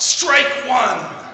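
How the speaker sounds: high, loud umpire-style call; yelled call. Retold or imitated. Pastor voicing the boy calling his own strike, loud high yell. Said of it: "And he yelled out".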